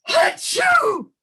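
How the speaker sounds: loud, high hammed-up sneeze; playful sneeze for the kids; mock sneeze. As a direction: burst out a big fake sneeze. Sneeze ends the song.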